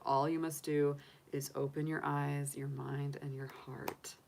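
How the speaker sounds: hushed, trailing to a near whisper; soft affirmation. Real, not acted. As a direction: let the affirmation sink in, softly. Closes a read-out affirmation softly.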